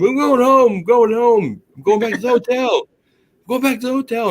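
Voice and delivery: high, loud holler across a big room; retold screaming. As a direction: re-enact shouting to a far-off buddy, pitch way up. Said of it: "trying to scream in this big room".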